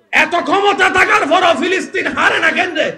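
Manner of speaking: high, drawn-out shouted complaint; exasperated Israel. Acted. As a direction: cry out as the baffled stronger side. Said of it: "Israel কয়". (হতভম্ব শত্রুর উঁচু গলার চিৎকার)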